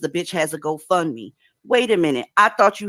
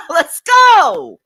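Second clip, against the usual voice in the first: much higher, shouted "Let's go!"; excited shout; 12 semitones higher, 9 dB louder than usual. Real, not acted. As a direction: yell it like a hype call. Host hypes up after laughing.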